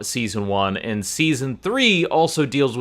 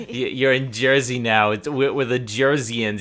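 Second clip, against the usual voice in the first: ordinary talk, nothing marked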